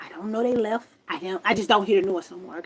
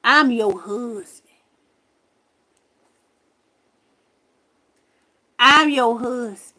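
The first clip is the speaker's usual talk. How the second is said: slow, deliberate announcement, lightly imitated; quoted ghost; 5 dB louder, slower than usual. Retelling what the ghost told her, slow lightly acted declaration. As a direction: spell it out slowly, twice. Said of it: "that mug told me".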